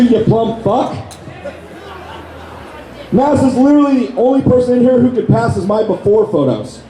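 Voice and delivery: normal talking voice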